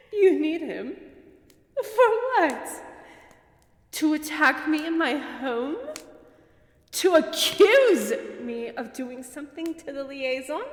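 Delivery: incredulous sneer; scornful disbelief. Acted. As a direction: scoff at each claim. Vampire scoffs at accusation.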